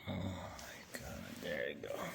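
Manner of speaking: whispering in relief to a settled pet. Real, not acted. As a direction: breathe it so the pet stays calm. Hushed relief near pet.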